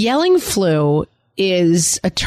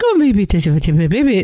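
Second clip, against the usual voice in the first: put-on baby-talk voice; faster than usual. Mother cooing to her baby all day, baby talk. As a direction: coo over a tiny baby. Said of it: "That's your voice all day".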